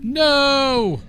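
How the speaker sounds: loud, high cry of dismay; sudden shout. Real, not acted. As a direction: yell it out in frustration. Sudden loss in game.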